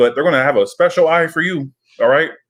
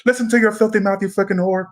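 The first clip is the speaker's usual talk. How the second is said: much higher, hammed-up crank-call voice; high, gleeful mock-obscene phone taunt; Serial Mom prank caller; 8 semitones higher than usual. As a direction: mimic the movie's unhinged prank caller, pitched way up. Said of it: "I don't know why I got that".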